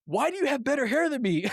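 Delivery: comic high-pitched protest; questioning while fleeing. Acted. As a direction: demand it over your shoulder, near laughter. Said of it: "I'm turning around asking him questions".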